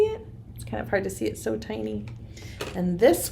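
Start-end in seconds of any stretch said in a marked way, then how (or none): 0.5-2.1 quiet. Real, not acted. Peering at tiny fossil.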